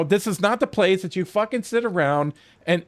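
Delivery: ordinary talk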